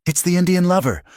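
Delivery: very high-pitched call, volume held back; accusing shout. A narrator's reading. Blacksmith pointing out Cal to the mob, high accusing shout. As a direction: call out an accusation over a crowd. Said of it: "a man in a blacksmith's apron shouted".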